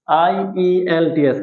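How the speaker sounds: আইইএলটিএস slowly spelt, I, E, L, T, S; letter by letter. Shown as a demonstration. Teacher introducing a course name, আইইএলটিএস spelt slowly. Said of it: "খেয়াল করো".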